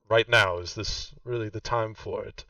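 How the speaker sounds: put-on a stern old mother snapping, loud on right now; sharp impatient rebuke. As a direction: cut them off, clearly annoyed. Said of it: "she kind of squints her eyes".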